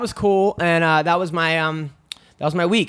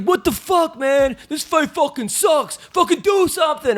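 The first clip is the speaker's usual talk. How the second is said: high, exasperated mock yell; Nick Diaz yelling; 11 semitones higher, slower than usual. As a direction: heckle a boring fight in an imitated holler. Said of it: "Nick Diaz is yelling this".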